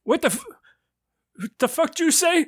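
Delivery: high, fast outraged squawk; a hint of a furious old man; high fast affronted shopkeeper; indignant old man.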